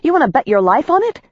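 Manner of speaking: fast, high, defiant challenge; challenging bark. A narrator's reading. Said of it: "I barked".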